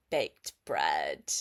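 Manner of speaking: usual speaking voice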